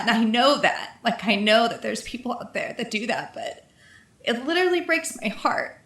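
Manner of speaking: real crying, halting with long pauses. Real, not acted. Host moved by hearing someone go through that, crying. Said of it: "I've never cried on our podcast".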